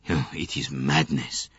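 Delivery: ordinary delivery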